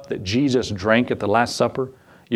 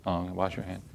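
much softer, lower mock repeat of wash your hand; muttered echo; 7 semitones lower, 14 dB quieter than usual. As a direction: mutter it back under your breath. Mock echo of nurse order.

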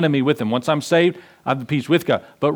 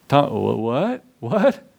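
stammered, rising disbelief; a hint of startled friends, stumbling over what; mock stunned apostles; slower than usual. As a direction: apostles catching Thomas out, mock amazed. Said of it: "the other apostles are going".

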